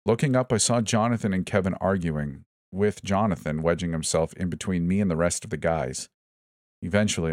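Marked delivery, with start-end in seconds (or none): none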